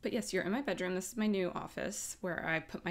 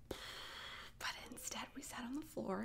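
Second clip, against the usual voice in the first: whisper; 4 semitones higher, 12 dB quieter than usual. Real, not acted.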